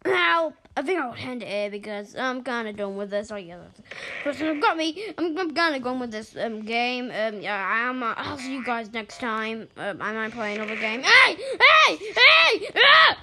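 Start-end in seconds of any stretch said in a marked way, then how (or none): 0.0-0.6 high, loud cries for help; alarmed screaming. Pretend. Player attacked during sign-off, screaming for help. 11.0-13.2 very high, loud repeated yells; outraged shouting. Real, not acted. Player interrupted mid-goodbye, outraged high shouting.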